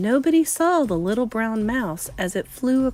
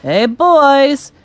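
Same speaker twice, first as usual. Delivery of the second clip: loud, raised-pitch hail; shouting; 4 semitones higher, 8 dB louder than usual. A narrator's reading. Waiter coming back in to the cooks, shouting. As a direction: call across a busy kitchen. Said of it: "he shouted".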